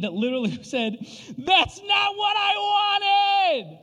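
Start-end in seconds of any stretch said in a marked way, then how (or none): 1.4-3.8 high, loud, drawn-out yell; screeching howl. Retold or imitated. Dad imitating daughter's Christmas gift meltdown, screeching howl. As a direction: wail it out like a child's meltdown. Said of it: "this howl, this whelping, this yelling".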